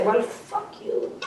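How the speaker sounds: slow, breathless whispered defiance; whispered curse. Retold or imitated. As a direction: spit it out under the breath, slowly. Ego's last quoted defiance.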